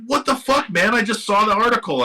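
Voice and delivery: surprised disbelief. Retold or imitated. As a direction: toss it off like an amazed, laughing buddy.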